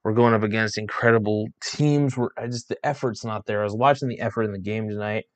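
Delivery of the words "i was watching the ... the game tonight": ordinary talk, nothing marked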